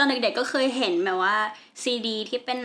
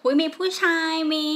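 higher-pitched, wowed exclamation; re-enacted amazement; 6 semitones higher than usual. Girl from all-girls school discovers boys, re-enacted amazement. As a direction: relive the thrill of a new discovery. Reliving first sight of boys.

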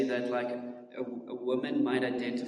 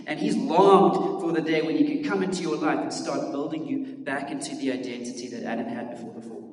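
ordinary talk, nothing marked

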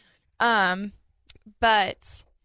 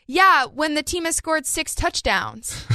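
higher, slow, confident mom voice; imitating mom; 7 semitones higher, slower than usual. Retelling mom explaining a pick six, higher and slow. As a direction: play mom sure of a wrong answer. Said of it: "she goes".

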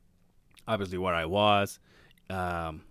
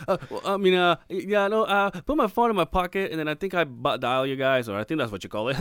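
halting, flustered explanation with high pitch; nervous stammer; 8 semitones higher, 5 dB louder than usual. Retold or imitated. Man explaining a butt dial to the emergency operator, nervous and stammering. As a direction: stammer it out, nervous and caught off guard. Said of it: "I sound nervous".

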